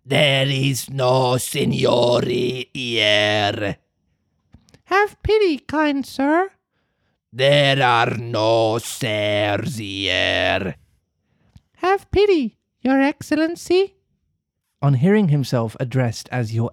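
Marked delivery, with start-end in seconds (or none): 0.0-3.8 gruff refusal. Acted. Fire Eater answers the plea. 4.9-6.5 very high, small puppet voice begging; pitiful plea. Acted. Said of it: "in a pitiful voice". 7.3-10.8 slow, low villain voice; gruff refusal. Acted. Fire Eater refuses again. 11.8-14.0 very high, small puppet voice begging; pitiful plea. Acted. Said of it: "in a pitiful voice".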